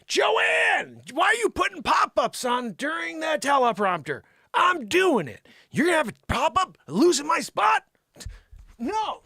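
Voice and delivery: high, shouted mock tirade; exasperated rant. Host scolds Joanne over teleprompter pop-ups, exasperated. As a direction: yell it high in hammed-up annoyance.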